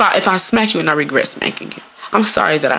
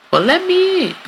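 playful plea; 14 semitones higher than usual. Acted. Knocking joke punchline.

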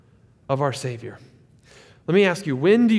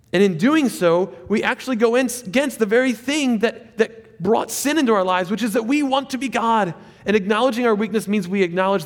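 high, loud sermon build; preaching at full force; 7 semitones higher, 4 dB louder than usual. Real, not acted. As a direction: drive the point home from the pulpit.

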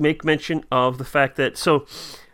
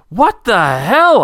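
high, loud, drawn-out bewilderment; exasperated disbelief; 6 semitones higher, 5 dB louder, much slower than usual. Retold or imitated. Writer answering sister's early phone call, loud drawn-out disbelief. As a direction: stretch it out, pitched up and louder. Reading aloud a quoted startled answer.

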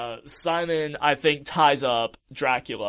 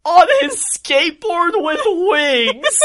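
very high, loud, drawn-out cry; loud mock-epic announcement; hyped-up exclamation; 14 semitones higher, 7 dB louder, slower than usual. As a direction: stretch the words out in a high, hammy yell. Hyped-up recap of Alucard's entrance.

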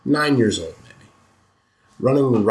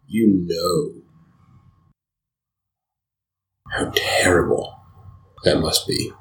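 lower, half-whispered aside; hushed narration; 4 semitones lower than usual. A narrator's reading. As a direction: let the horror sink in under your breath. Narrator hushing a grim moment.

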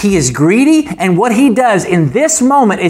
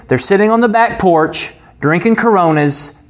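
ordinary delivery

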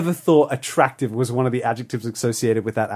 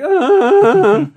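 high, loud cracking "ah"; voice-crack cry; 9 dB louder than usual. Shown as a demonstration. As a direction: let a loud yelp crack upward. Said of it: "where I do the voice crack".

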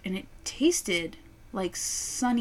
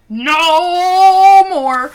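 loud; 9 semitones higher, 17 dB louder than usual. Retold or imitated. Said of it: "I was like".

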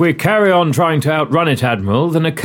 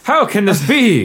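put-on nativity play child; 7 semitones higher, 5 dB louder than usual. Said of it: "like a seven-year-old in a nativity play".

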